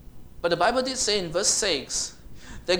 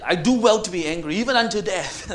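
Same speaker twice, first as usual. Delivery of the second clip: much louder, stubborn declaration; defiant shout; 2 semitones higher, 9 dB louder than usual. Acted. Jonah defiant tantrum.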